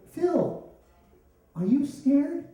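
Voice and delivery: put-on high, loud question to a child; mock-concerned high voice; alarmed check.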